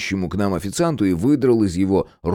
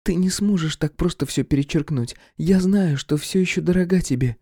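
voiced woman Irka; 9 semitones higher than usual. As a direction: press her claim on him. Irka insists.

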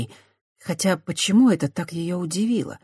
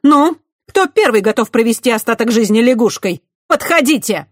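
much higher and louder, defiant; shouted challenge; 8 semitones higher, 9 dB louder than usual. A narrator's reading. Christiana shouting a bluffing dare at the knights. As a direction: yell a dare at them. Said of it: "выкрикнула".

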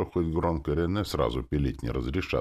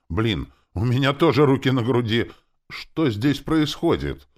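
high, loud bewildered exclamation; alarmed outburst; 7 semitones higher, 6 dB louder than usual. A narrator's reading. Man wakes laid out as dead, loudly bewildered. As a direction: demand to know what is happening.

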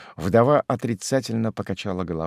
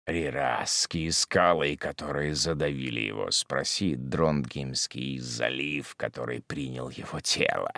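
grim hermit; 6 semitones lower, 7 dB quieter than usual. Acted. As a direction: speak low and heavy, measured as an oracle. Hermit speaks in story.

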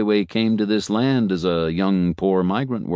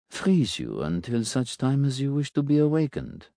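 a hint of a calm, softer doctor; lightly voiced doctor; 6 semitones higher, 4 dB quieter than usual. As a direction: speak as a measured physician. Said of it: "Doctor Graff said".

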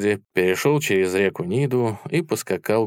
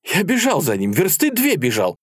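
put-on high, elated young soldier; breathless happy excitement; 8 semitones higher than usual. A narrator's reading. Young soldier bragging of the chase, happily excited. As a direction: tell it in a happy high rush. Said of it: "задыхаясь, счастливым молодым голосом".

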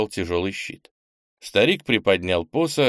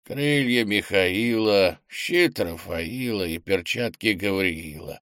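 очень медленная речь старика; тяни слова, как старый призрачный дух; slower than usual. A narrator's reading. Старый дух перечисляет артефакты. (медленный голос старого духа)

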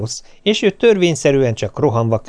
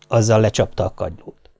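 usual speaking voice

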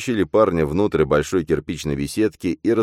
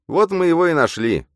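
high, loud, triumphant sidekick; high-pitched triumphant shout; excited exclamation; 6 dB louder than usual. A narrator's reading. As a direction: crow about the find, pitched way up. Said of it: "воскликнул двойник".

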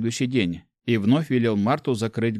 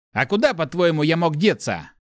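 higher, annoyed retort from the inner voice; раздражённо; irritated; 7 semitones higher, 3 dB louder than usual. A narrator's reading. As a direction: snap back, a touch irritated. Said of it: "раздражённый голос".

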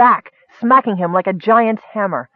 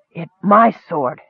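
plain reading voice

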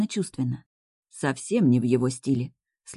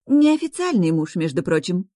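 pitched-up quick retort; blurted out; 4 semitones higher, 4 dB louder than usual. Acted. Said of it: "выпаливает подруга".

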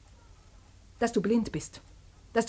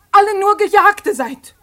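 near tears; 5 semitones higher, 6 dB louder than usual. Acted.

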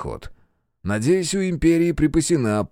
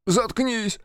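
high furious snapping; angry outburst; 13 semitones higher than usual. Acted. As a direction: lash out, losing control. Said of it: "сорвался инженер".

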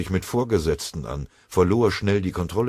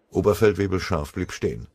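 ordinary delivery, nothing marked

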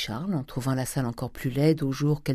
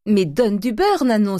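louder, higher urgent exclamation; insistent, raised-voice command; exclaimed command; 7 semitones higher, 5 dB louder than usual. A narrator's reading. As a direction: cry out an impatient order to the maid. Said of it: "s'écria Eugénie".

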